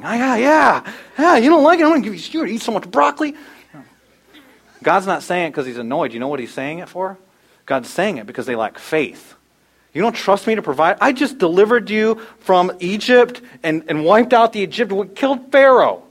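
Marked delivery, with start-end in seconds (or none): 0.0-3.4 high, loud, exasperated parent voice, pitched well up; hammed-up scolding parent at a picky eater; mocking a fussy parent. Parent mocking a child who won't eat, high and exasperated. 9.9-16.0 God rebuking Israel. Retold or imitated. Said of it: "he's saying to them".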